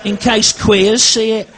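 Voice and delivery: shrill grandma in full indignation; outraged nan. Retold or imitated. Nan grumbling to grandson Stu, high squeaky outrage.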